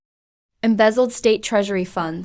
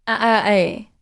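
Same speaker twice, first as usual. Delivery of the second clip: ordinary delivery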